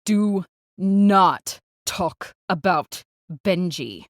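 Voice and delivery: loud, slow, word-by-word warning; on the edge of anger, spacing out every word. Acted. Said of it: "My tone is on the edge, ready to fall into a canyon of anger and despair".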